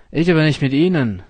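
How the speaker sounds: loud, fast, sharp refusal; energetic. A narrator's reading. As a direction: shut him down forcefully. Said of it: "sagt er energisch".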